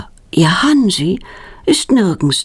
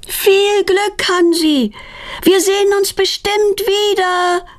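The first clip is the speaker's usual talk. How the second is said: high, sustained farewell call; sung-out high-pitched goodbye; calling after; 13 semitones higher, slower than usual. A narrator's reading. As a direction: call after a bird flying away. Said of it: "ruft Petronella ihm nach".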